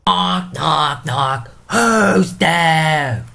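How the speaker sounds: loud, high theatrical shout at the door; calling out. Acted. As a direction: bellow like a drunken gatekeeper demanding who knocks. Porter calls out to knockers.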